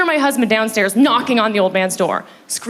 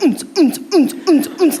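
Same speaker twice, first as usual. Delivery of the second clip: short punchy rave bass beats; imitated techno thump; 4 semitones higher than usual. Comic shows the music through the floor, imitated thump. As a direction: mimic a pounding bass beat. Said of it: "all you hear is".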